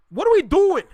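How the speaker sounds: loud, high exasperated yell; high-pitched cry of frustration. Real, not acted. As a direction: shout it in disbelief at your own people. Ranting at fellow Canadians.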